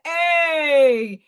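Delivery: long drawn-out Staten Island "A"; imitated Staten Island accent, drawling vowel. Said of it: "you got the Staten Island accent still".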